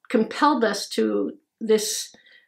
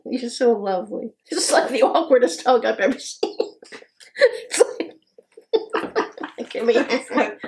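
normal talking voice

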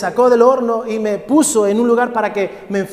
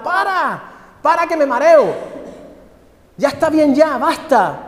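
dizzy cup screaming; 8 semitones higher than usual. Acted. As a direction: yell it while the wheel spins you. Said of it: "yo le gritaba".

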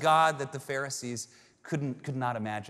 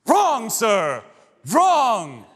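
high, loud, manic Wonka outburst; crazy Willy Wonka; 8 semitones higher, 13 dB louder, much slower than usual. Retold or imitated. Willy Wonka turning on the grandpa, in a crazy loud rant. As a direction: snap it out like an unhinged showman. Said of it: "goes into this crazy thing".

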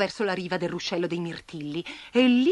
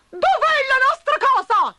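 high, loud alarmed ant voices; ants crying out; 7 dB louder than usual. A narrator's reading. Ants seeing their anthill gone, high loud alarm. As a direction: cry out like ants who lost home.

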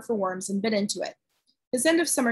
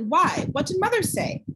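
sharp; 8 semitones higher than usual. A narrator's reading. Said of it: "Meg said sharply".

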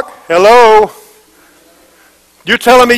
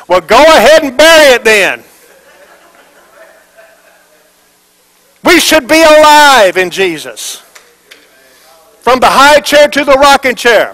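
raised, fervent preaching voice, laughing at first; preaching at full force; 4 semitones higher, slower than usual. Acted. Pastor rousing a flat congregation, fervent preaching. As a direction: lift the church up, proclaim it.

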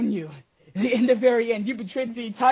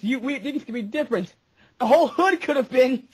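ordinary delivery

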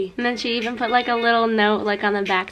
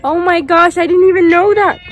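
louder and higher, real shock; shocked outburst; 6 semitones higher, 11 dB louder than usual. Real, not acted. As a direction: blurt it out, caught off guard. Missed a friend's birthday.

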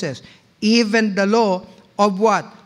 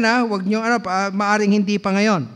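ordinary talk

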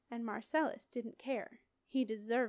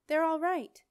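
relieved excitement; 6 semitones higher, 8 dB louder than usual. Acted. Sally relieved after the crash.

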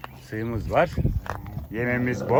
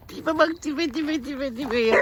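much higher, softer doting coo; 12 semitones higher, 3 dB quieter, slower than usual. Real, not acted. Man dotes on the foal's face, high soft baby talk. Cooing at a donkey foal.

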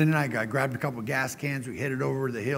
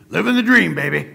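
put-on big, loud, high-pitched "Living the dream, baby"; booming carefree trucker catchphrase; burly trucker; 5 semitones higher, 12 dB louder than usual. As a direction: belt it out like a cheerful burly trucker. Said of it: "He goes".

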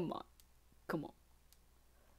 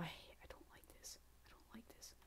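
nervous whisper; 2 semitones higher, 19 dB quieter than usual. Real, not acted. Nervous on a hard section.

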